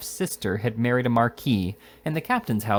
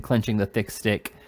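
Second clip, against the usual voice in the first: ordinary delivery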